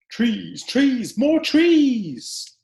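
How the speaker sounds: from eager call to loud, high exclaim; rising plea that bursts out at the end; building excited cry. Acted. Character's rallying cry in story.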